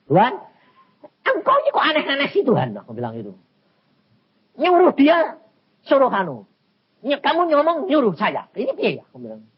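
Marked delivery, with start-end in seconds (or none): 0.1-2.8 high, quick, half-laughing protest; exasperated complaint. Retold or imitated. Preacher complains to God alone in his room, exasperated. 4.6-9.0 loud, high-pitched mock complaint; bewildered protest. Preacher protests God's conflicting orders, bewildered and high.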